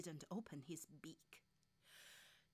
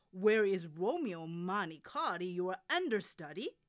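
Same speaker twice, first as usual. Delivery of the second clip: loud, demanding call; director calling out; 12 dB louder than usual. Acted. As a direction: summon someone across the theater. Director calls for understudy.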